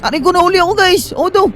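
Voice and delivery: high-pitched cry of dismay; panicked yelp. Pretend. Player caught by the monster, panicked.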